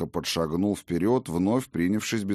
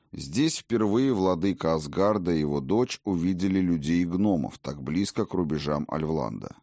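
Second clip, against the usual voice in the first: usual speaking voice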